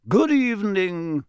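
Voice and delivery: high, slow, sing-out greeting; genial, courtly ghost; Nearly Headless Nick. Acted. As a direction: stretch the greeting out graciously.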